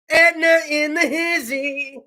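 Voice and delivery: imitating the same hype call; hype reporter again. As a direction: repeat the hype call, easing off. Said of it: "sit on the back of this dump truck".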